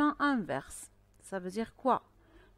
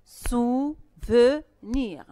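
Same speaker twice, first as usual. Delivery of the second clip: "Souvenir" very slow and loud, beat by beat; syllable by syllable; 8 dB louder, much slower than usual. Shown as a demonstration. Teacher has pupils clap the word, syllable by syllable. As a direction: break Souvenir into three separate beats. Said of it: "Frappez souvenir".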